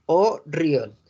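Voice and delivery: "O río" in two parts, ending on O; silent T. Shown as a demonstration. Teacher repeating the French word, "O río" with silent T. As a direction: say "O río" with no final T. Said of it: "Y la T no se pronuncia".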